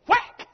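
shouted high sound-effect whack; sudden loud mock whack. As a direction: smack it out like a rod strike. Sound effect of rod strike.

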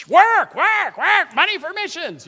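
high, loud nagging chant of work; mock slave driver. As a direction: crack the whip like a slave driver boss. Voicing Kathy as slave driver.

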